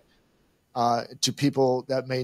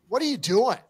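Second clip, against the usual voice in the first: higher, louder indignant challenge; confronting; 9 semitones higher, 4 dB louder than usual. Retold or imitated. Golfer confronting the lady on the course, high indignant. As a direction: call her out, fed up. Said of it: "I basically said".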